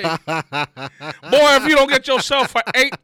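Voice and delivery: hammed-up scolding through laughter, slowing at the end; teasing a friend who is tired after a short shift; mock outrage.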